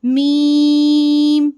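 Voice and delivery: मीम held very long and loud, like a chant; drawn-out letter name. Shown as a demonstration. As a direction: stretch मीम out slowly and strongly. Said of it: "इसके हिज्जे क्या करेंगे".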